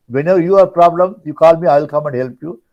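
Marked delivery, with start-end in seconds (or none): none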